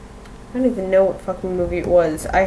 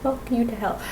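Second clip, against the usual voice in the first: soft, slightly higher mock retort; graphics card's retort; 3 semitones higher, 10 dB quieter than usual. Gamer mimicking her Nvidia card refusing everything, soft mock retort. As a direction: quietly voice the stubborn graphics card, word by word. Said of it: "It just goes".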